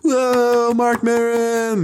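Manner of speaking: high, drawn-out cheer for the comic; mock crowd cheer. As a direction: fake a long, high crowd holler. Mimics crowd greeting comedian's entrance.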